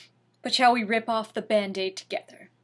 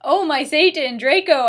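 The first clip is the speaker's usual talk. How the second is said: loud, high mock-passionate cry; scream; 5 semitones higher, 8 dB louder than usual. Narrator screaming at Draco in the fanfic. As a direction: ham it up like a scream. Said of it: "I screamed".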